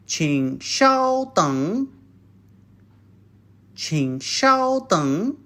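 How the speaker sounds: 请稍等 said slowly for the class to copy; slow and clear, for repetition. Shown as a demonstration. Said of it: "means wait for a while".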